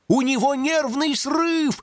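high, slow horrified cry; terrified shout. A narrator's reading. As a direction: cry out in horror. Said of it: "в ужасе закричал ёжик".

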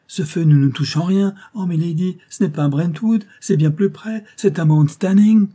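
high, loud, insistent; frightened maid insisting, voice lifted; urgent alarm. A narrator's reading. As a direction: build to a loud cry of alarm. Maid insists fire is nearer.

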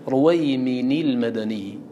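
رويمين said ruwaymini with an added i before المدني; slow رويمين, tanwin broken with i; رويمين pronounced ruwaymin-il, the i clear; with kasra. Shown as a demonstration. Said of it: "الأصل في دفع التقاء الساكنين".

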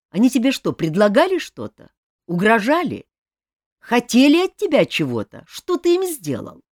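agitated outcry. A narrator's reading. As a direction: demand answers in anguished agitation. King pressing Jacques in anguish.

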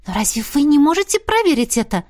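high-pitched surprised question. A narrator's reading. Heroine questions the dragon's inability to check, surprised. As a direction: ask in open surprise. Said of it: "удивилась я".

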